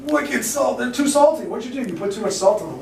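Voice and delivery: complaining tourist. Retold or imitated. Shucker mimicking visitors who find the oysters too salty, high mock outrage. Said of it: "and then they'll go".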